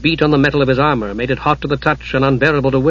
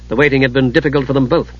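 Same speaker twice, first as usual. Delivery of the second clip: ordinary delivery, nothing marked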